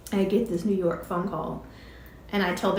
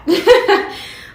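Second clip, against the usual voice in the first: high, loud laugh; big amused laugh; 12 semitones higher, 12 dB louder than usual. Real, not acted. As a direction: laugh at how chaotic it was. Laughs at the crowded house.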